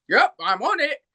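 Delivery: mimicked reply in an English accent; silly English accent. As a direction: echo it as a silly English character. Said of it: "slip into these silly English characters".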